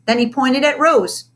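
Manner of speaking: usual speaking voice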